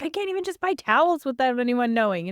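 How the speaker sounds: high, loud, fast exasperated complaint; retold frustration. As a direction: imitate an exasperated client venting. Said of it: "he was so frustrated".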